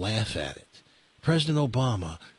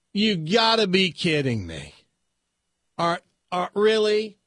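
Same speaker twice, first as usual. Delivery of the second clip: higher, incredulous biker; can't believe a grown man is crying; 7 semitones higher, 4 dB louder than usual. Acted. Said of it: "biker goes".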